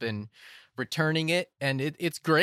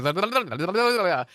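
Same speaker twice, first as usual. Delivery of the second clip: higher, rattled-off mock chatter; rapid Gilmore Girls chatter; 6 semitones higher, 3 dB louder, faster than usual. Shown as a demonstration. Imitating Gilmore Girls rapid banter for a co-host, mock chatter. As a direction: rattle off the blahs breathlessly, like a gabby sitcom. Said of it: "they're just talking like this".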